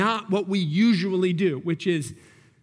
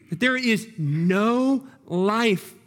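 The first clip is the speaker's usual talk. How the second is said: ordinary talk, nothing marked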